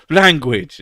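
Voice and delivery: loud scolding rebuke. Retold or imitated. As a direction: snap it like a prim scold. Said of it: "Someone goes".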